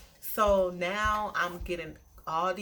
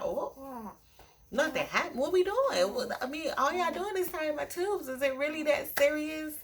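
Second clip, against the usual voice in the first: high incredulous protest, voice climbing; shocked, pushing back at the nurse; retelling her panicked reaction to the nurse, high and incredulous; alarmed disbelief; 9 semitones higher than usual. Retold or imitated. Voicing her alarmed self to nurse.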